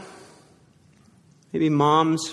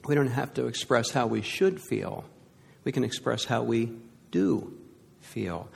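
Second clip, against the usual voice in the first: softened tone; 4 semitones lower, 6 dB quieter than usual. Real, not acted. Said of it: "gentle point".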